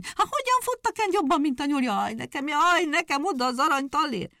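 high, loud wailing lament; hedgehog's wife keeps lamenting the bet, high and loud. Acted. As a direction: despair over the gold coin.

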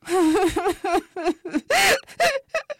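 high, loud hammed-up wailing; acted cartoonish crying fit; bitter sobbing. A narrator's reading. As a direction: bawl in hiccupping sobs. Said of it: "Keservesen sírt és hüppögött".

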